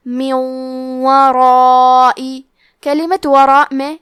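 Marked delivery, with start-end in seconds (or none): 0.1-2.4 من ورائی very slow, nun merged into a nasal doubled واو; nasal merge. Shown as a demonstration. Teacher demonstrating idgham with ghunna, slow. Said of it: "غنہ اور تشدید کے ساتھ ادا کریں گے".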